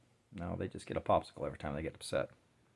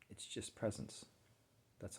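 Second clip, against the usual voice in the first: whispered; 11 dB quieter than usual. Shown as a demonstration. Softly naming the pain's nature.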